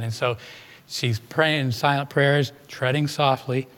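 ordinary delivery

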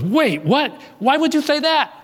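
high-pitched, startled retold questions; taken aback. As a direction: blurt it out in high-pitched disbelief. Caller startled by her unexpected praise.

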